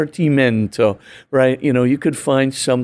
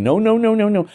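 panicked pleading; 8 semitones higher, faster than usual. Retold or imitated. Lizard begging not to be removed.

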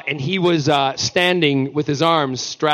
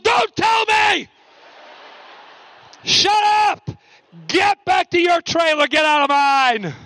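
hammed-up outburst, much higher; mock panicked shouting; 13 semitones higher, slower than usual. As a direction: shoo him away before spoilers. [name] shouting at David, mock.

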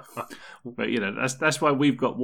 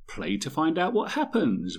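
high, drawn-out, over-eager slogan; exaggerated sing-out of a printed motto; mock exclamation; 7 semitones higher than usual. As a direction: stretch it out high like a chirpy exclamation. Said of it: "with an exclamation mark at the end of it".

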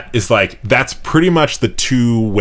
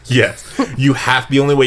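mock authoritative; 5 semitones higher than usual. Repeating in a put-on voice.

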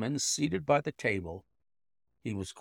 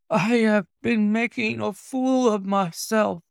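higher, louder, short of breath; gasping; 9 semitones higher, 6 dB louder than usual. Acted. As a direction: gasp it out, spent. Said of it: "he gasped".

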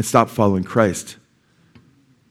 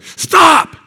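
sudden high, loud "stop" shout; urgent shout; 6 dB louder than usual. Retold or imitated. Preacher re-enacting shout at charging sushi chef, urgent yell. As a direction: bellow it to halt an attacker, pitch leaping up.

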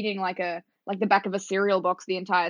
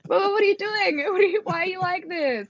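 high-pitched, bewildered protest; baffled, high-voiced outcry; exasperated disbelief; 10 semitones higher than usual. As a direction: plead in mock dismay, pitched way up. Acted dismay at new narrators' changes.

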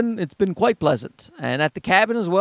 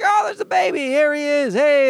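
put-on much higher, cooing over the baby; delighted greeting; 9 semitones higher than usual. People in public spotting the baby, high delighted cooing. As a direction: coo at the baby like a delighted stranger. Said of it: "They're like".

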